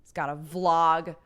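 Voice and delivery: loud, exaggerated mock whining; mocking complaint. Friends making fun of her vlogging, mock complaint.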